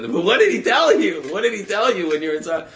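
high, loud and fast, bursting to know; a rabbi desperate to hear what was said, calling out high and loud; eager pressing question. Retold or imitated. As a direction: press him urgently for the answer. Rabbi eagerly pressing the old man.

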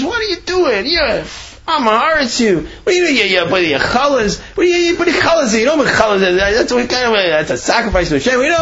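high-pitched, agitated mock tirade; imitated screaming scolding. Rav catching the Yid leaving challahs, mock screaming. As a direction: scold in a high, tumbling rant without real volume. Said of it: "He starts screaming".

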